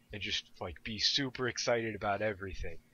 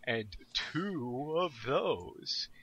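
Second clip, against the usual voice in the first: slow, halting beginner voice; halting language student; 4 semitones higher, 4 dB louder, much slower than usual. Retold or imitated. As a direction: sound out each word like a beginner. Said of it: "while they go".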